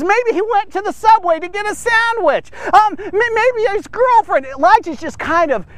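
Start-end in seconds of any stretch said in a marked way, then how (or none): 0.0-4.5 much higher, sing-song ridicule; mocking taunt. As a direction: lay the mockery on thick. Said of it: "he's really jamming the guys".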